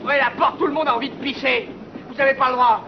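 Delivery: high, loud frantic yelling; desperate shouting. Acted. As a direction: pound the door and scream to get out. Locked prisoner begging to be let out.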